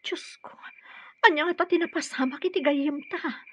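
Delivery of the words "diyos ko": much softer, gasped whisper; shocked whisper. Acted. Friend whispers in shock at the news. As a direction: breathe it out in shock.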